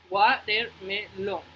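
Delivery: "Watermelon" slowly in four separate beats; sílaba por sílaba. Shown as a demonstration. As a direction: break "Watermelon" into wa, ter, me, lon. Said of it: "dos sílabas". ("Watermelon" despacio, separado en sílabas)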